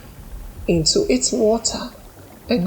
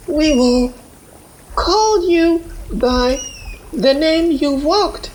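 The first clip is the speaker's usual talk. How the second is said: a woman's earnest, raised voice blessing a new name; village woman; 10 semitones higher than usual. A narrator's reading. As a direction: make a promise of respect. Woman honors Amina.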